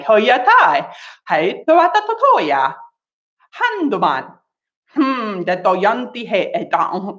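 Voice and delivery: made-up syllables in a speech cadence; gibberish. Shown as a demonstration. Teacher demonstrates gibberish for solo practice. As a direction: babble nonsense as if it were real language. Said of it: "we might speak in gibberish".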